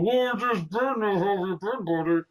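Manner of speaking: talking through clenched teeth; mock wired-jaw voice, lips barely moving; jaw wired shut. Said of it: "I had my jaw wired shut".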